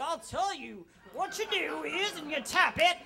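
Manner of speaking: put-on loud, high know-it-all old-timer; amped-up hostess. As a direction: explain it eagerly and loudly, like a seasoned user. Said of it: "got, like, really amped up".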